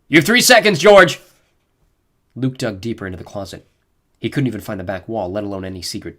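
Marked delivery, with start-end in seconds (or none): 0.1-1.2 loud, high yelled threat; gruff yell. A narrator's reading. Officer yelling at George through door. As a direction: bark the countdown through the door. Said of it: "a gruff voice yelled out".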